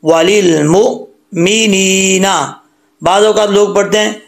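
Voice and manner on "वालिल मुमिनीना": very slow वालिल मुमिनीना, sharp stop after मु; jolted hamza. Shown as a demonstration. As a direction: cut मु dead, pause, then stretch नी. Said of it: "झटके के साथ".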